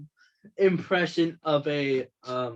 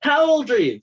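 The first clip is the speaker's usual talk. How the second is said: louder, higher hammed-up magician; mock showman; 9 semitones higher, 8 dB louder, faster than usual. Mocking a YouTube magician with loud higher showmanship. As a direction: boom it like a cheesy stage magician.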